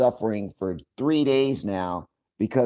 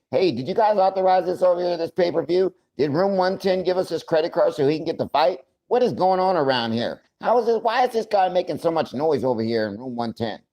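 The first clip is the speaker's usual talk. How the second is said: mock staff; 7 semitones higher than usual. Hospital staff voiced, puzzled.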